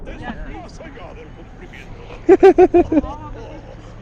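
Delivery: breathy laugh. Real, not acted. Laughing at elephant.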